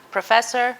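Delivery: ordinary talk, nothing marked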